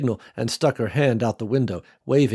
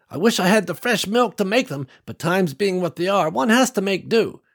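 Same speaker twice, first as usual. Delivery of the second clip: put-on high, cheerful old matriarch welcoming guests; old farm woman welcoming strangers, cheerful and high; kindly old woman; 8 semitones higher than usual. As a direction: beam at them like a proud grandma.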